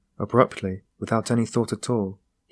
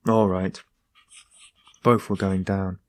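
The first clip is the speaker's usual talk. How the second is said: ordinary delivery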